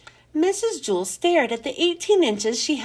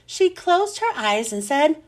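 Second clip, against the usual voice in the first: plain reading voice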